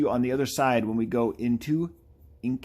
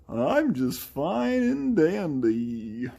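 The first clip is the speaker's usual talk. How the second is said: high, very slow put-on voice; game character voice; 6 semitones higher, 3 dB louder, much slower than usual. Game character saying how they are, high drawn-out voice. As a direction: stretch each word in a raised character voice.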